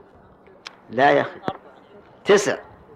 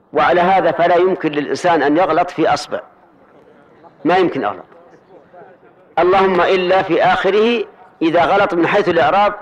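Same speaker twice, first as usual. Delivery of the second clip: normal talking voice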